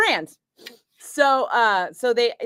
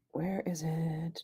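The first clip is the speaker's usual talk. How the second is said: breathy whisper to herself, slow; 3 semitones lower, 13 dB quieter, slower than usual. Real, not acted. Searching the screen for a post, whispering slowly. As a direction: hunt for it under your breath.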